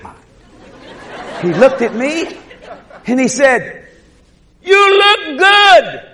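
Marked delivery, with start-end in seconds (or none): 4.6-6.1 high, loud, very drawn-out words; booming call. Retold or imitated. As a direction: stretch each word in a long holler. Stranger in vision calling out.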